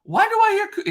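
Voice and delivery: high, loud imitation of an Italian; mock Italian exclamation; Daniele, Italian accent. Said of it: "and he's Italian".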